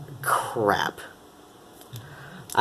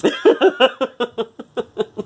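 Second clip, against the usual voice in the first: high laugh; 10 semitones higher than usual. Real, not acted. Laughs after accent bit.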